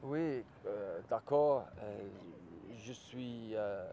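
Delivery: halting attempt at a French accent; a shy, unfinished go at sounding like a Frenchman. Shown as a demonstration. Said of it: "Could you give us an example of your French accent?".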